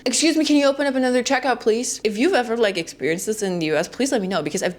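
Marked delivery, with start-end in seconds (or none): 0.1-2.0 quick, higher, overly polite request; hammed-up courteous shopper calling out fast; mock polite call. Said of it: "yell from the back of the line".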